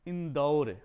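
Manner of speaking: इंदौर said loud, with a clear औ; loud, clear demo of the right इंदौर; correct pronunciation. Shown as a demonstration. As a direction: announce the correct इंदौर firmly, with a full औ. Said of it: "उच्चारण शुद्ध होगा".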